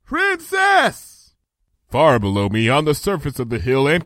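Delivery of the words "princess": high-pitched call from far above; shouting down. A narrator's reading. Twilight shouts from the tower parapet to the princess, calling shout. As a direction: shout it down to someone far below. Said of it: "I shouted down from the parapet".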